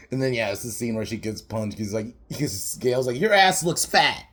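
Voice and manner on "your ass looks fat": higher, louder bitchy jab; catty mock taunt. Gale insults Cindy, catty mock taunt.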